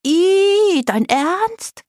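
very high squeal of revulsion; high-pitched girlish "eww" reaction; disgusted shriek. A narrator's reading. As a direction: screech it in disbelief. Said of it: "kreischte Greta".

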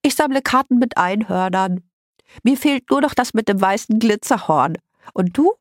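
put-on congested little-girl voice; stuffed-nose girl. A narrator's reading. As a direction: talk proudly with your nose bunged up. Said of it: "Ihre Stimme klang, als wäre ihre Nase verstopft".

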